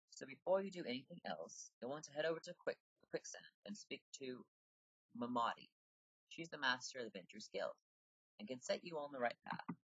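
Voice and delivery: put-on high-pitched British accent, on the soft side; British female character voice. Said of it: "make British accent really high".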